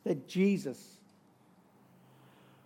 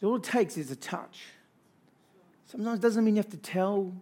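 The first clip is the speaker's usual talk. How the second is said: normal talking voice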